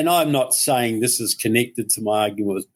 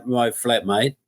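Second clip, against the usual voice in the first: usual speaking voice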